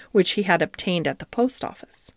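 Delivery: ordinary delivery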